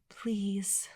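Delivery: soft murmured plea; quiet weary please. A narrator's reading. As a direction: murmur it, barely there. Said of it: "she murmured".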